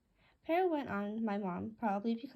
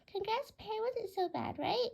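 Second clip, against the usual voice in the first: bird voice; 9 semitones higher, slower than usual. Acted. Bluey congratulates Pear.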